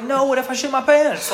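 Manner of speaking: high, loud retold yell; laughing, alarmed holler; yelling across the office. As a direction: shout a protest to someone rooms away. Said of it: "we're yelling across the office".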